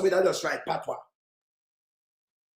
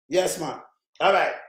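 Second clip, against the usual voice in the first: ordinary delivery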